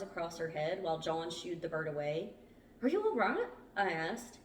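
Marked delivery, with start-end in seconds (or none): 2.8-3.5 high, loud worried question; alarmed. A narrator's reading. Narrator to Meg just hurt by a bird, alarmed. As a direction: call out in urgent concern.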